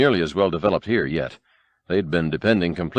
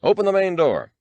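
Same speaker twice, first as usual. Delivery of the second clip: high, crisp command to open the door; a commander barking an order, lightly voiced; commander's order; 9 semitones higher than usual. A narrator's reading. Commander ordering the bunker door opened, brisk command. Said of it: "ordered the commander".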